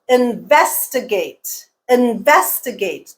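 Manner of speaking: "Investigate" slowly, in-VES-ti-gate; stress on second syllable. Shown as a demonstration. Teacher giving an example, slow second-syllable stress. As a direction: stretch the word, punching VES in "Investigate". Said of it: "stress is on the second syllable".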